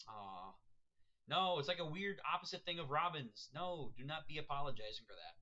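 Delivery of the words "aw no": ordinary talk, nothing marked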